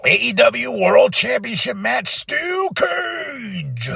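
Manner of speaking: high, drawn-out ring announcer call; bill it like a ring announcer. Pretend. Announcing the steel cage main event, announcer style.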